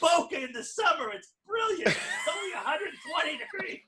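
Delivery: high, hammed-up pushy salesman, laughing through it; mock salesman pitch. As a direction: oversell it like a cheesy timeshare rep. Mocking a pushy timeshare salesman.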